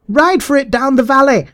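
very high, sustained, urgent yell; shouting. A narrator's reading. Little man urging escape down the valley, shouting. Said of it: "the little man was shouting".

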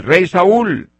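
calling out. Retold or imitated. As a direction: shout it across to a king. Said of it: "le grita, le dice".

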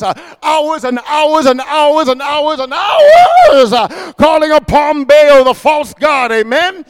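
slow, loud preaching shout. Acted. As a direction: stretch each word out in a loud sermon cry. Preaching at full force.

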